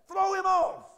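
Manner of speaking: loud, high shout; commanding shout. Real, not acted. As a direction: bark it at the church. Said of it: "The Bible never said ride him".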